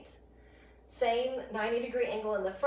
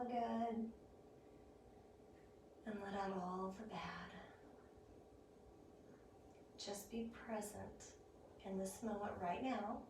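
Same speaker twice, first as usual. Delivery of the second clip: soft spa whisper; 9 dB quieter than usual. Acted. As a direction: ease them down gently, barely voiced. Leads relaxation at end of class.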